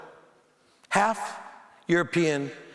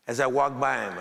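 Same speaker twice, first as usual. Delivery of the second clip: usual speaking voice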